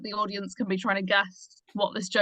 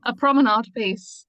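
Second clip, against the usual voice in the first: louder and slower, spelling it out for a listener who missed it; repeated more clearly; 2 semitones higher, 7 dB louder than usual. Real, not acted. To a colleague who missed the word, a louder, slower repeat. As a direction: say it again slowly and firmly so they catch it. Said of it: "A what? Sorry?".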